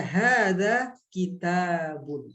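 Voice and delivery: very slow, drawn-out hadza kitabun; slow demonstration. Shown as a demonstration. Teacher showing the omitted word, slow and drawn out. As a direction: spell out "hadza kitabun" unhurriedly.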